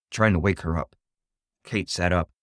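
plain reading voice

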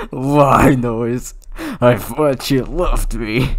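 loud, high hurt disbelief; loud wounded whine; loud betrayed cry. Acted. Betrayed character cries out.

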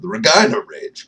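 "Regina" with a stressed long "eye" in the middle; Regina rhyming with vagina. Real, not acted. Vlogger relishing the team's name, "Regina" said ruh-JYE-nuh. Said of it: "that's how you pronounce that city's name".

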